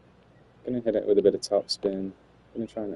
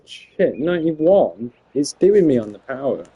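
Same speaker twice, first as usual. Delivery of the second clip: loud exasperated swearing at the game; frustrated outburst; 5 semitones higher, 8 dB louder, slower than usual. Real, not acted. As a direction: vent loudly at the shot. Frustrated gamer missing his power.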